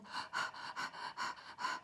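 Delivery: quick breathy pants, acted; panting. A narrator's reading. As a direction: pant as if worn out from chasing. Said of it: "He was panting".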